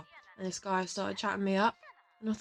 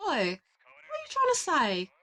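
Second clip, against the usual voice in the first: indignant, rising higher and louder; mock offence; 7 semitones higher, 4 dB louder than usual. Mock-offended friend snapping back at the bartender teasing. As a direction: play it huffy, voice climbing up.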